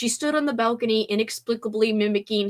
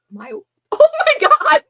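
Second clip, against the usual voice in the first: laughing outburst; 9 dB louder, slower than usual. Real, not acted. Frustrated stumbling over name.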